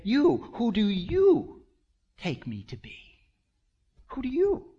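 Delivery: YOU stretched high and set out first; stress on YOU. Shown as a demonstration. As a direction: punch each YOU, slow and high. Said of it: "Specifically, we would say it".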